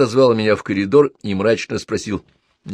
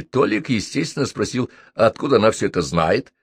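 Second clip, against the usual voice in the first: usual speaking voice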